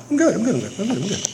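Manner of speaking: quick, repeated little bursts; rapid self-reassurance. Retold or imitated. Man tells himself he has no problem, rapid self-reassurance. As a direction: rattle it off to convince yourself. Mocks his own forced acceptance.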